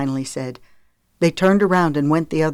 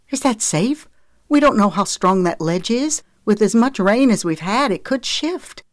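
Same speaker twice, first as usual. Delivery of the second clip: high, anxious female voice; worried mother; 6 semitones higher, 3 dB louder than usual. A narrator's reading. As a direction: question the risky plan with rising worry. Mother worrying over rescue.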